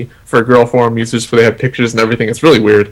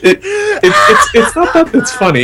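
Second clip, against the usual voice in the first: much higher, giggling speech; laughing through words. Real, not acted. Explaining the prank to his co-host while laughing. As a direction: try to explain while cracking up.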